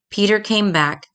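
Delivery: usual speaking voice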